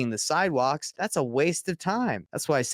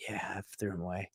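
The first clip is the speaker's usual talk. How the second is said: sheepish quiet admission; 7 semitones lower, 13 dB quieter, much faster than usual. Retold or imitated. Worker admits throwing flyers.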